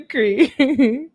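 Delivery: high, loud squealing laugh; shrieking laugh. Real, not acted. Host reacting to the boyfriend's animal rant, squealing laugh. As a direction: burst into a shrill cackle of disbelief.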